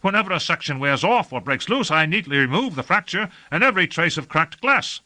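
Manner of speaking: high-pitched, insistent underling; high voice of an aggrieved caretaker; protesting servant. A narrator's reading. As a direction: defend your cherished machine in a high voice. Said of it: "protested Jambart".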